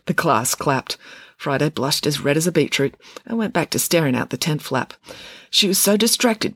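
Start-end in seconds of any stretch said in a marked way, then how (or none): none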